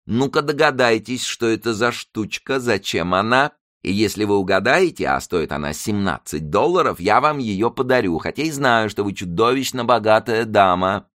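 high, fussy character voice; teasing shopkeeper Zaika. Acted. Zaika challenges a rich customer to guess, teasing.

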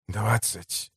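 low, soft echo of the offer; quiet scornful sneer. A narrator's reading. Slave trader scoffs at the mayor's price, quietly scornful. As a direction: repeat it quietly with disdain. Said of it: "оскалился Шкин".

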